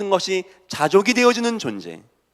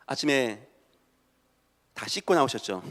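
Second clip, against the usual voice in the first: ordinary talk, nothing marked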